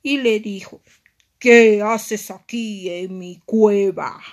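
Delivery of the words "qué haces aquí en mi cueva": loud, slow villain voice; wicked mermaid. Acted. Evil mermaid finds Aria in her cave, menacing. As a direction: menace the intruder, slowly and loudly. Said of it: "muy, pero muy malvada".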